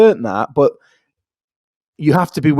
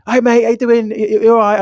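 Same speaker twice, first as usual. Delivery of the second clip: cheery lad greeting; 7 semitones higher than usual. Retold or imitated. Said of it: "one of the lads went".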